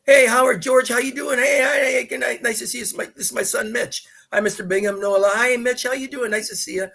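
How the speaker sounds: high, quick, upbeat hellos; acted greeting. Son retells dad greeting Howard Bingham, acted-out hearty hellos. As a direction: play out a cheery meet-and-greet. Acting out greetings of friends meeting.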